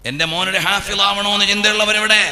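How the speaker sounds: loud, high fiery sermon shout; preaching at full force. Acted. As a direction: thunder it out to the crowd.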